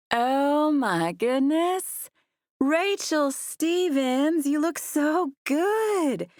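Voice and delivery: very slow, high Southern belle drawl. A narrator's reading. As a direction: stretch every word in a sugary Southern accent. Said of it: "in her slow Southern drawl".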